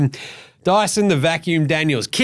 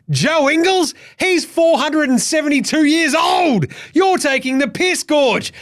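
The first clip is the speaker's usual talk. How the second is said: exasperated shout; 7 semitones higher than usual. Real, not acted. Shouting in disbelief at the coach's pick. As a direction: blow up at the selector. Ranting about old player picked.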